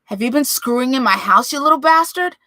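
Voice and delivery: roaring, rising rage; furious shout. Acted. Father roaring at his son, furious shout. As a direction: bellow it like a charging bear. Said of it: "lunges at the new gambit like a bear".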